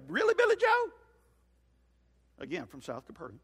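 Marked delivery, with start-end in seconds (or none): 0.1-0.9 squeaky high-pitched "really?"; amazed disbelief. Acted. Billy Bob to Billy Joe, hearing the rumor, shrill amazed falsetto. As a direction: jump way up into a shocked falsetto. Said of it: "Billy Bob looks at him and goes".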